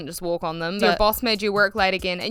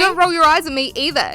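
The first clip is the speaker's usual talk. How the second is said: raised voice; 7 semitones higher, 4 dB louder than usual. Acted. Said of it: "he said, raising his voice".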